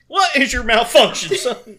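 loud, high barked question; drill sergeant. Pretend. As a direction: chew out a recruit. Drill sergeant quotes continue.

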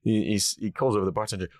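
ordinary talk

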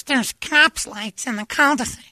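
very high falsetto imitation, drawn out; put-on high, slow woman's voice; Megan. Imitating her alerting him to police lights, high falsetto. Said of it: "and Megan goes".

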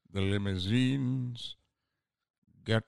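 slow, dark, drawn-out English actor; Alan Rickman. Retold or imitated. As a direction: stretch each word out, languid and dry. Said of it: "He goes".

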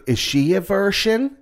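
high, drawn-out imitation; retold question. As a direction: stretch the mock question up high. Retold question about the little kid.

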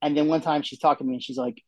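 ordinary talk, nothing marked

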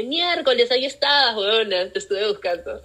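playful joyful discovery; delighted surprise. Retold or imitated. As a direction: exclaim in happy shock at the mirror.

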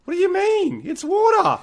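shrill disbelief, way up high; high-pitched outrage. Retold or imitated. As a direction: squeal it in total disbelief. Ranting incredulous at countries.

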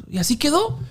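usual speaking voice

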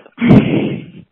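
breathy giggle at own joke; stoned giggle. Real, not acted. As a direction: giggle helplessly, a bit high. Voicemail caller laughing.

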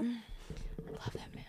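breathy whispered crush confession; whispered, swooning. Real, not acted. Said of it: "Oh my gosh".

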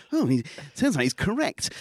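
grudging crowd murmur. Pretend.

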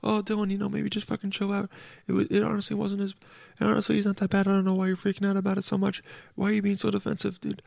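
high, soft teasing listener voice; mocking listeners. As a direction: play a listener telling Dylan to calm down. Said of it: "that's what you guys are saying".

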